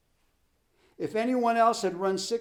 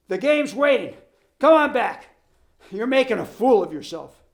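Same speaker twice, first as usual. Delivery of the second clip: shouting; 5 semitones higher, 9 dB louder than usual. Acted. Coach yelling at runner.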